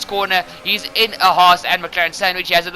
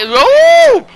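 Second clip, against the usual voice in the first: sharp startled yelp, much higher and louder; sudden surprise; 10 dB louder than usual. Real, not acted. Commentator spots a sudden incident, startled yelp. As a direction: caught off guard by the crash.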